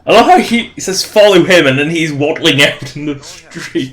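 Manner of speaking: amused, squeaky and loud; gleeful, high-pitched commentary; excited high voice. Real, not acted. Player laughs at the fat character.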